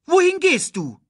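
high, loud demanding question; calling out. A narrator's reading. Timon asks where Nala is going, calling out. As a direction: call after someone walking off. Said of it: "rief Timon".

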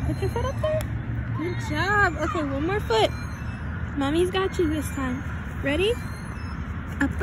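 high, loud cheering parent voice; encouraging mom. Real, not acted. Mom coaching daughter climbing at park.

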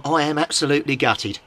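ordinary talk

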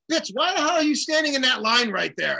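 high, punchy outburst of disbelief; mock scolding. Friend popping by the line to scold him, mock indignant.

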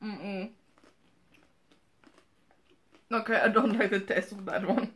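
talking while chewing; mouth full, chewing. Real, not acted. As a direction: hand over full mouth. Seen 0: Left woman bites snack at -1, chews, later covers mouth.

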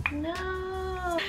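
thin high whine; high squeaky no. Real, not acted. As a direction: whine in frustration. Product won't come out.

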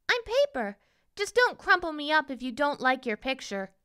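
high-pitched, lively princess voice; storybook princess. A narrator's reading. Princess reassures the boy about drawing on her, high voice. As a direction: voice a paper princess, pitched well up.

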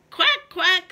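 high, loud quacking; duck quack. Acted. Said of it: "said the duck".

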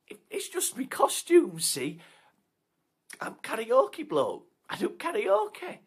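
high, loud, eager; relieved karaoke bloke. Acted. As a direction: gush with relief.

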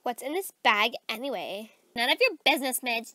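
usual speaking voice

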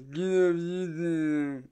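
slow, altered mocking whine; whiny voice. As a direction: mimic the troll's whiny voice. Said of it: "with that voice it does".

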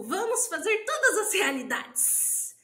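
mock-gushing progressive teacher, pitched high with drawn-out words; singsong hype, stretching the words; experimental-school teacher. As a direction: parody an airy, over-enthusiastic educator. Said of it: "aí tem as experimentais, que é tipo".

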